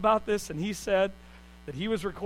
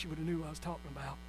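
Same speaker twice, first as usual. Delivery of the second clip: presidential voice; 4 semitones lower, 12 dB quieter, much faster than usual. Shown as a demonstration. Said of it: "if I would have done it in this voice".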